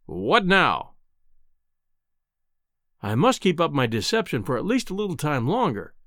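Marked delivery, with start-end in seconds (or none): 0.1-0.9 haughty, challenging question; insolent. A narrator's reading. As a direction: challenge the intruder with open impatience. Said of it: "with a most insolent stare".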